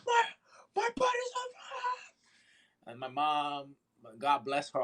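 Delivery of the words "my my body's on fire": slow, very high mock wail; screaming in pain. Child insisting to confused family, slow high mock wail. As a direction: drag it out in a thin, squealed cry. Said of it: "screaming in pain".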